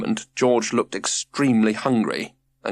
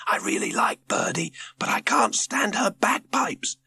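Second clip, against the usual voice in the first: whisper; 11 semitones higher than usual. A narrator's reading. Jemima keeps whispering under bagpipes.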